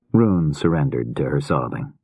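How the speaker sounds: ordinary delivery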